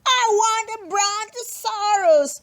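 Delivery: put-on high spoiled little girl whine; whiny demanding child. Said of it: "Lulu kept saying".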